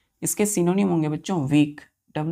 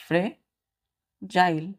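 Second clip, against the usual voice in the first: Fragile stretched out slowly, syllable by syllable; slow teaching demo; much slower than usual. Shown as a demonstration. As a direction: draw out fragile for the class. Teacher drawing out the word. (धीरे-धीरे खींचकर fragile)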